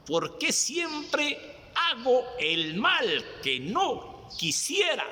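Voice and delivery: high, slow, pleading cry to God; drawn-out anguished plea, pitched high; anguished lament. Acted. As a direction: stretch it out like a soul in torment. Anguished sinner's lament in sermon.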